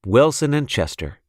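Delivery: plain reading voice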